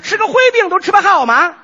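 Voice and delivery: put-on furious young dragon prince shouting in a Tangshan accent. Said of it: "一个唐山三太子".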